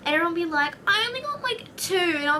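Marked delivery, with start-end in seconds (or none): 0.9-2.0 put-on high whiny kid voice; mock kid pitched high; complaining kid. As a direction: whine it high like a short-changed child. Whiny kids complain about cake.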